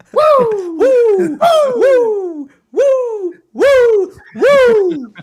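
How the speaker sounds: very high, loud wrestling woo; screaming woo like a wrestling legend; Ric Flair woo. Retold or imitated. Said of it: "do it five times".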